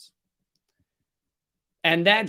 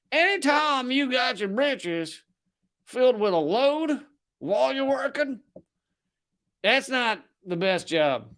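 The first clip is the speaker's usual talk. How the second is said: Southern accent impression; 5 semitones higher than usual. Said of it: "this guy that I'm in, impersonating right now".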